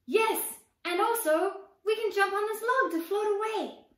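high, loud eager voice; excited little girl. Acted. Little sister proposing the log to her brother, excited. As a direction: share the idea with glee.

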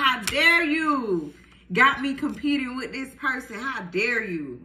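mock outrage, slowed right down; feisty. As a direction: lean hard on each word like a jealous ex. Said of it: "Come on now with the feistiness".

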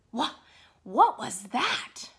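usual speaking voice

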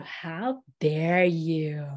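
low, slow smiling "How dare you?"; half-amused mock outrage; smiling indignation. Shown as a demonstration. Teacher showing the class a smiling how dare you. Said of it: "you're all smiling at the end".

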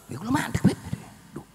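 normal talking voice

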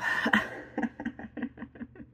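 a breathy laugh through real tears; teary laugh. Real, not acted. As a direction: laugh it off while crying. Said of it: "crying like a baby over here".

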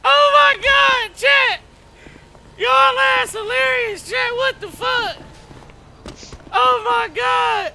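very high, drawn-out, helpless wail; laughing to tears. Real, not acted. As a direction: squeal it out, overcome with laughter. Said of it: "I'm crying".

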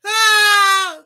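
high, loud laughing shriek; laughing cry. Real, not acted. Streamer cracks up at chat with high loud shriek. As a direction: let out a squeal of laughter.

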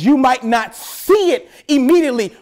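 ordinary talk

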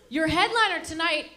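ordinary delivery

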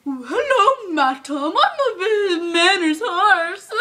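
mocking a polite British horse, drawn out. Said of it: "Rocky over here is going".

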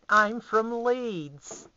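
silly exaggerated announcement; mock Leeds voice. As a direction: stretch it out in a hammed-up put-on voice. Repeats line in put-on voice.

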